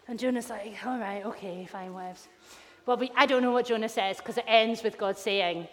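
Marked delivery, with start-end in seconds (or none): 0.8-2.3 low, soft, trailing-off concession; mock teenage-style reluctant agreement; grudging sulk. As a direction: give in sulkily under your voice. Invented sulky Jonah reply.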